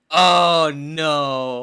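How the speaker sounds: loud, drawn-out groan of realization; big hammed-up lament; mock dismay. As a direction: wail it in exaggerated horror. Retells realizing his own cliché.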